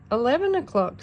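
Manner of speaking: ordinary delivery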